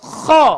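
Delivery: kha khi khu rasped loud and high from the throat; vibrating kha. Shown as a demonstration. Teacher drilling kha with vibration. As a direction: scrape the kh hard. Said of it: "ha ngono tok kurang".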